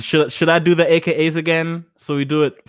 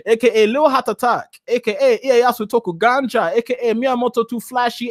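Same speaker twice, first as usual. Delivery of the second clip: put-on high-pitched, emphatic Nigerian accent; 6 semitones higher than usual. Streamer reeling off his nicknames to viewers, Nigerian accent. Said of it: "I'll do it in my Nigerian accent".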